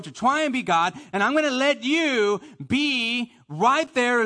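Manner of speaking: loud, high, impassioned preaching; full-force preaching. Acted. Preacher surrendering his will to God, impassioned full-force preaching. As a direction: build it to a loud, pleading crescendo.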